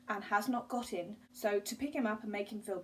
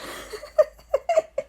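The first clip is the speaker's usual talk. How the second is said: loud, high cackling laugh; real cackle; 11 dB louder than usual. Real, not acted. Vlogger overcome by a book reference, loud cackle. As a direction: burst out cackling. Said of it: "Sorry, I'm cackling".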